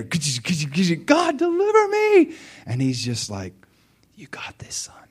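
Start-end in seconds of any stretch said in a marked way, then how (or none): 0.0-2.3 very high, mock whining plea; mock whiny plea. Preacher mocking the believer who just begs, whiny and high. 4.1-5.1 hushed, unvoiced encouragement; fatherly whisper. God whispering encouragement to his son.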